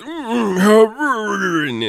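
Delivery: high, muffled hum through a taped mouth; muffled squirming hum. Retold or imitated. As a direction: hum as if gagged and struggling. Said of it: "They duct tape his mouth shut".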